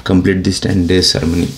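ordinary talk

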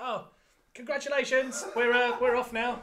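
high, loud, breezy goodbye; mock cheery farewell. Guests mocked leaving after the fight, high and breezy. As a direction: chirp it out like nothing happened.

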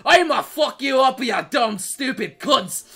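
loud, ranting Scottish threat; hammed-up Scottish fury, cracking up at the end; pissed-off Scottish. Shown as a demonstration. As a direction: shout it like an enraged Scot. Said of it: "sound like you're pissed off all the time".

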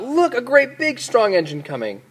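high, excited clown call. A narrator's reading. Little clown spots an engine coming, calling out. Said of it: "Little Clown called out".

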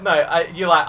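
ordinary talk, nothing marked

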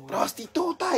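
high, drawn-out mock catcall; imitated street yell. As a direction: imitate someone yelling from down the street. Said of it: "vuelve a gritar otra vez". (grito callejero imitado, agudo y alargado)

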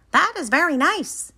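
very high Frog voice; high-pitched, approving little Frog; Frog, impressed. A narrator's reading. Frog admiring Toad's list, high-pitched and impressed. Reads Frog's line in story.

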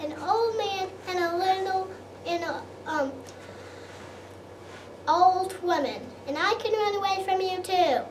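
high shouting gingerbread man. Acted. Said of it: "he shouted out".

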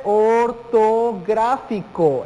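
"ortográfico" stretched into or, to, grá, fi, co; slow and syllabified. Shown as a demonstration. Teacher gives students the answer, slowly syllabified. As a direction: draw out each syllable of "ortográfico".

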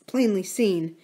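usual speaking voice

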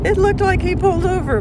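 high, fast and excited. Real, not acted. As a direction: rush it out, pitch way up.

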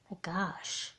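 near-whispered to oneself; quiet private astonishment; hushed amazement. Acted. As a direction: breathe it out softly, stunned. Alone, still stunned by the dream.

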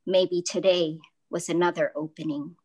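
ordinary delivery

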